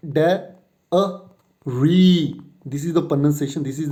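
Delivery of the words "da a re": Da, a, re split apart with the weight on re; slow syllables, re stressed; syllables spaced out. Shown as a demonstration. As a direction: break it into three and lean on re. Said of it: "the stress will not be on the first syllable".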